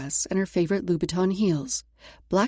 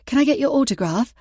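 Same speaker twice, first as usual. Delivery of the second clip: put-on high, loud, eager British accent; gushing fan in a British accent; 5 semitones higher, 6 dB louder, faster than usual. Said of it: "using her best British accent".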